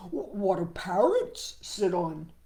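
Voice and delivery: slow, lightly voiced frog; storybook frog questioning. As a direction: ask it like a puzzled little frog. Frog asks, voiced character.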